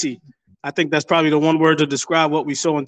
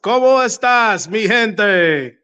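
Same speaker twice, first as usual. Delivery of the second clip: slow, higher, booming crowd greeting; lucha libre announcer; 5 semitones higher, much slower than usual. Acted. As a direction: stretch it out for the arena.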